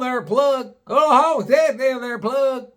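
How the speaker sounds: put-on high, loud garbled good-old-boy voice; incomprehensible local. Retelling a garage man's talk, high mock-local holler. Acting out hard-to-understand garage man.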